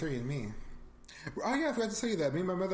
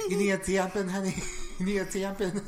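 higher, louder mock Wisconsin mom; Wisconsin accent; 5 semitones higher, 4 dB louder than usual. Imitating the movie's Wisconsin family, hammed-up Wisconsin accent. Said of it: "run into this Wisconsin family".

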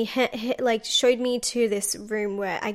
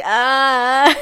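mock pained groan; 7 dB louder than usual. Groaning in the taxi back seat.

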